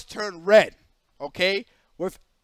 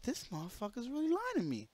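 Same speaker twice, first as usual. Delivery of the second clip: much softer, seething under the breath; quiet retold anger at being lied to; muttered fury; 2 semitones higher, 15 dB quieter than usual. Retold private fury at boss.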